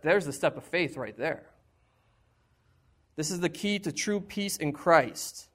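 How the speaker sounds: ordinary delivery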